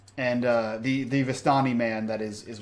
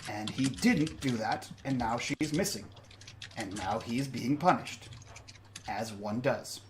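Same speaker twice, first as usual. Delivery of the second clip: quiet menace; 6 dB quieter than usual. Acted. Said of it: "He says".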